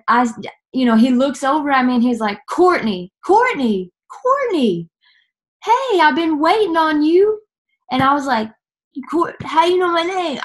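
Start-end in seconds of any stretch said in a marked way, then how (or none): 2.5-7.4 high sing-out of a name, climbing; imitated calling out. Imitates stranger calling out to her. 9.1-10.4 high, startled question; retold astonishment. Acts out her startled reply.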